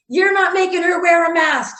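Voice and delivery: high mock yell at the store owner. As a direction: play the angry customer, pitched high. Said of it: "he yells at the owner".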